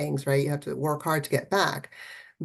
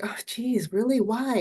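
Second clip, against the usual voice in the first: weary exasperation; 7 semitones higher than usual. Pretend. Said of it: "And it's like".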